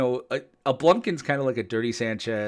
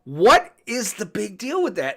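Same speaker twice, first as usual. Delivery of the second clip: exasperated wife; 11 semitones higher, 8 dB louder, slower than usual. Retold or imitated. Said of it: "Wendy's like".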